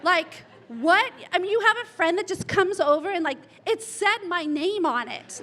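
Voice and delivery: hammed-up mad friend, half laughing. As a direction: act his outrage while laughing. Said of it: "And Mike was so mad".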